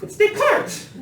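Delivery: high-pitched exclamation of a punchline; exclaiming. Real, not acted. Exasperated explanation of his joke.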